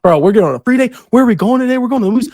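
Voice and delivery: high, loud, bursting with free-day excitement; pumped up and loud, calling out the plan; excited as fuck. Retold or imitated. To his bandmate about the free day, loud and thrilled. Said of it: "I'm excited as fuck".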